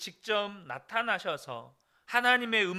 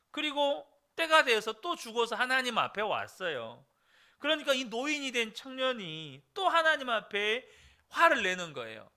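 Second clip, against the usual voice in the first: ordinary talk, nothing marked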